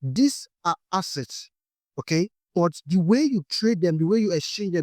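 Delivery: ordinary talk, nothing marked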